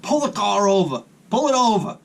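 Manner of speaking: mock yell, pitch rising; retold yell. Retold argument with wife.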